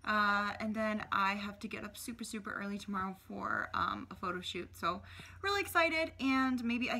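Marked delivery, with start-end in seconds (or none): none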